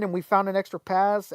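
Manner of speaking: ordinary delivery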